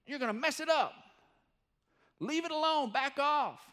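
a mock baker snapping stop that; hammed-up angry baker; scolding baker. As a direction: shoo them off his cake, half playful.

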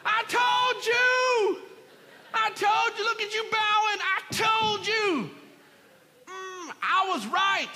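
loud, high whooping gloat; gloating triumph. Acted. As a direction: crow over them in a drawn-out, hammed-up shout. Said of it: "could have had his moment and popped up".

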